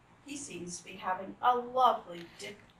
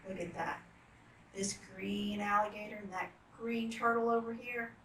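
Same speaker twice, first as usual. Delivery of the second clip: usual speaking voice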